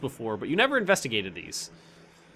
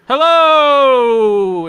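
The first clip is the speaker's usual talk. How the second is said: loud, high shout into the dark shaft; shout down the hole; 12 semitones higher, 17 dB louder than usual. Acted. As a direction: yell it down into the pit. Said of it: "Aquilus looks over the edge and just goes".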